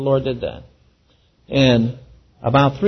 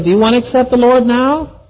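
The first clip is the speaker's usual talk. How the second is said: higher, louder insistent ask; 10 semitones higher, 4 dB louder than usual. Retold or imitated. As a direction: press him once more, a bit higher and louder. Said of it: "So I went another hour".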